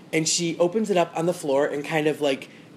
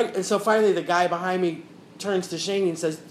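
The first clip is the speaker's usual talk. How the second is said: ordinary talk, nothing marked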